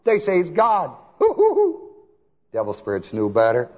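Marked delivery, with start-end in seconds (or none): 1.2-1.8 high mocking hoo hoo; mocking hoots. Preacher scoffing at so-called Christians, high mocking hoots.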